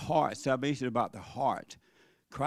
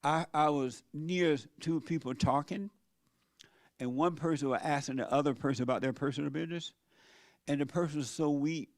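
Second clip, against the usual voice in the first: usual speaking voice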